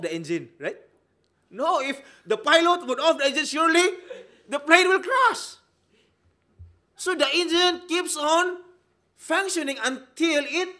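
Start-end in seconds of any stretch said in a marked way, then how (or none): 1.5-10.7 louder and higher, slowing on crash; rising preaching shout. Real, not acted. Preacher warning the congregation the plane will crash, shouting. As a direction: build to a warning cry.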